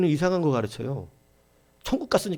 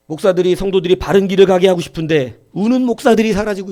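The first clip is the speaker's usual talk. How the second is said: higher, much louder impassioned sermon; full-force preaching; 8 semitones higher, 12 dB louder than usual. Acted. Pastor urging the congregation to weep, preaching higher and much louder. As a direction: preach it out high and loud to stir them up.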